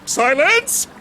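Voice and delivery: sharp command. Acted. Officer silencing his wavering soldiers, sharp command. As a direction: bark for silence.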